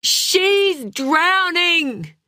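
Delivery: high, drawn-out cry of alarm; desperate yell. A narrator's reading. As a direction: stretch each word in a slow, high yell. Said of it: "He yelled, louder than he'd yelled in years".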